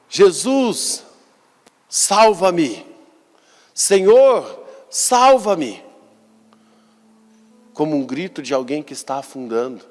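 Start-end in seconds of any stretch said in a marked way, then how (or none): 0.1-5.8 high, loud cry for rescue; desperate cry. Retold or imitated. Peter sinking in the waves cries out to Jesus, high and loud. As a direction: call out like a man going under. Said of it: "ele gritou".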